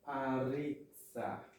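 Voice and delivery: "areza" in three slow syllables; slow counterexample "areza", middle vowel voiced; wrong pronunciation. Shown as a demonstration. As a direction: put a stressed e after the r in "areza". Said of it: "E não".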